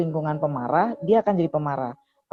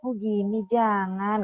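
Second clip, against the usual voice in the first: higher, mock-sweet and over-gentle; affected gentleness; 3 semitones higher, much slower than usual. Shown as a demonstration. Adviser mocking forced gentleness for a mother, affected sweet voice. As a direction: overdo the softness, as a bad example. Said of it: "Nggak begitu kan, jelek banget kan".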